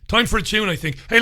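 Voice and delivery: ordinary talk, nothing marked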